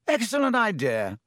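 bright, high eager approval; eager Lockhart. A narrator's reading. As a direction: jump at the idea. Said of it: "said Lockhart".

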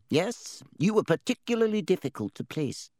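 high, knowing hat voice; talking hat. Acted. Sorting Hat speaking.

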